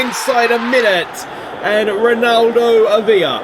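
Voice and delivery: high, loud goal shout; excited goal call. Real, not acted. Gamer calling his goal, loud and high. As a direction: celebrate the early goal. Goal scored in football game.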